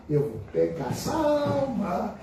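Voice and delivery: hammed-up sinister devil; devil's voice. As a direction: gloat like the devil plotting. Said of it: "E o capeta fica lá".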